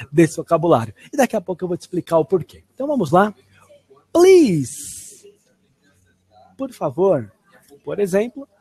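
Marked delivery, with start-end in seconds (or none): 4.1-5.3 high, loud, drawn-out plea; dramatized. Shown as a demonstration. Teacher modelling "please" for learners to repeat, dramatized. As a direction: say it big with exaggerated emotion. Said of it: "colocando dramatização".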